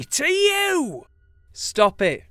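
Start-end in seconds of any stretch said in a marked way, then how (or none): 1.5-2.2 loud, higher, desperate shout; urgent, pleading yell; crying out. A narrator's reading. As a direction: cry out for it to end. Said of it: "Reese cries out".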